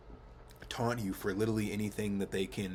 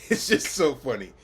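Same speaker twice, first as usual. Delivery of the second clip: loud, higher, real laughter breaking in; laughing; 5 semitones higher, 12 dB louder than usual. Real, not acted. Vlogger admitting to using silly slang, laughing. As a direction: crack up while saying it.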